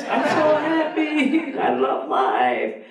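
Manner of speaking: high, slow, laughing bliss; drawn-out giddy joy; euphoric. Retold or imitated. High on mushrooms, dancing.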